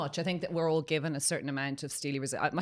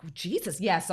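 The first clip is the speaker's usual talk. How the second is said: usual speaking voice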